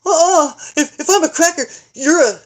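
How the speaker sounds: high, loud, laughing comeback; kid comeback. Retold or imitated. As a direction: fire back like a proud little boy. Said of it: "And he says".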